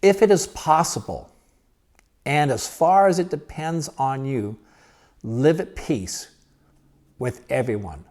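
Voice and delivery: deliberate pauses between phrases; slow reading. Real, not acted. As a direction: read it slowly, letting each phrase sink in. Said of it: "I'm gonna read it slow".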